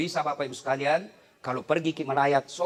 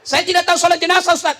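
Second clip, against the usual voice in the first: higher, louder complaining congregant; 11 semitones higher, 8 dB louder than usual. Acted. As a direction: fuss like a worried worshipper. Jamaah bertanya kepada ustaz. (jamaah mengeluh, suara tinggi)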